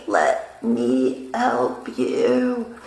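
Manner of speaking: slow, low, squeezed out while being choked; choked words. Retold or imitated. Choked against the copy machine, gasping her offer to help. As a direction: force the words out past a grip on the throat.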